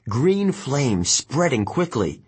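usual speaking voice

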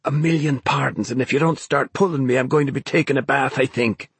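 mock apology while straining; strained sarcasm. A narrator's reading. Ben to Naomi as he slides toward water, strained sarcasm.

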